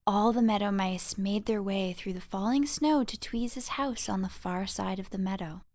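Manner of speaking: plain reading voice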